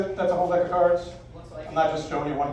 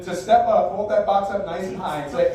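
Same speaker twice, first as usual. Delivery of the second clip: ordinary delivery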